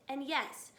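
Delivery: usual speaking voice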